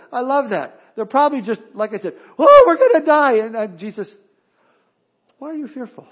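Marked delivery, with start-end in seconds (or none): 2.4-3.3 very loud, high mock wail; hammed-up panicked cry; freaked-out disciples. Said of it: "the disciples are freaked out". 5.4-6.1 soft, gentle question after panic; calm, hushed reassurance. Acted. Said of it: "He said that very calmly".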